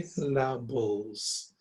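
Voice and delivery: SYLLABLES slowly, split into si, la, bles. Shown as a demonstration. Teacher corrects the squashed version, word in separate syllables. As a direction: stretch SYLLABLES into three clear beats. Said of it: "making a one syllable".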